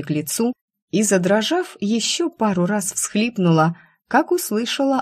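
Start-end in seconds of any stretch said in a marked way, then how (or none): none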